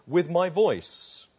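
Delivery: "With my voice" drawn out slow and loud; stress on VOICE. Acted. Preacher showing what the psalmist specifically says, emphatic "With my voice". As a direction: hammer out "With my voice" word by word. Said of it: "But he specifically says".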